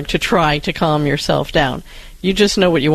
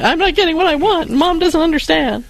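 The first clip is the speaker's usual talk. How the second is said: put-on pitched-up voice of an upset small child; upset little kid; 10 semitones higher than usual. Cohost voicing a thwarted toddler's inner thoughts, pitched-up upset kid. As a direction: voice the child's frustration, pitched well up. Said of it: "the red noise in their head of saying".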